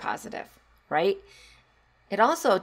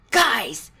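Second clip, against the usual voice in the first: put-on high, loud, slowed child's alarm; little girl warning; 11 semitones higher, 7 dB louder, much slower than usual. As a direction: warn the class like a wide-eyed kid. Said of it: "and she said".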